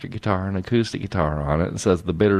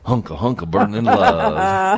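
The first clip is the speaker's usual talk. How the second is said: high, laughing love-lyric quip; playful laughing quip; 5 semitones higher, 4 dB louder than usual. Pretend. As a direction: ham it up, breaking into laughter. Joking song-lyric quip while laughing.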